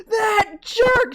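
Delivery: high, loud angry cry; furious outburst. Retold or imitated. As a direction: spit it out in rage. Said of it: "She got so mad".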